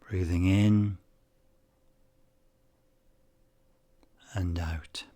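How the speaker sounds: unhurried meditation guide, long pauses between phrases; calm, measured guiding voice, phrase endings gently falling away; guided meditation. Acted. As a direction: ease the listener into calm focus, leaving space after each phrase.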